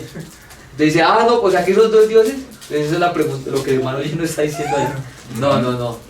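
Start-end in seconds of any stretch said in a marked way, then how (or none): 1.0-2.3 higher and louder, feigned disbelief; a hammed-up gotcha, louder; mock incredulous objection. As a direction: play the opponent who just caught a contradiction. Mocking the other side's objection.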